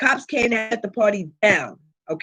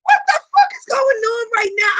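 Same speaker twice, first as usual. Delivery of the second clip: shocked outburst; 10 semitones higher than usual. Retold or imitated. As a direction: yell it like the world just flipped. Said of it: "And I'm like".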